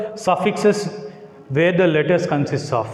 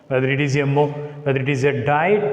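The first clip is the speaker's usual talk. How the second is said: ordinary delivery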